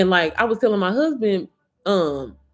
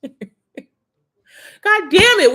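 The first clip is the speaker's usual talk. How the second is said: laughing curse; 9 semitones higher, 8 dB louder, much slower than usual. Real, not acted. Laughing outburst at her own story.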